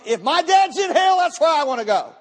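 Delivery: imitated defiant teenage daughter; defiant outburst. Rebellious daughter's defiant retort to mother.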